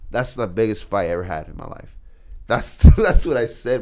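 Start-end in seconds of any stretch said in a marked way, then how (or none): 2.5-3.8 laughing. Real, not acted. Laughs while boasting.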